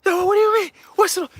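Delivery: shrieked, very high and loud; bugging out. Retold or imitated. Mom hearing it was the Lord, shrieking astonishment. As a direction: mimic mom losing it in disbelief. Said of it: "She's bugging the fuck out".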